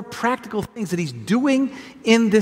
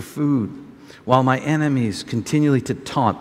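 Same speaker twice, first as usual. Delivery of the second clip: lower, measured reading of a psalm; calm, lower scripture reading; 6 semitones lower than usual. Acted. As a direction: read it solemnly to the church. Reads the psalm aloud.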